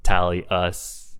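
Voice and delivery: Tallius as tally-US, ending fully sounded; pronunciation variant. Shown as a demonstration. Guest lists a third pronunciation, Tallius as tally-US. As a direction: say Tallius like "tally" plus a clear "us". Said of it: "people pronounce it all over the place".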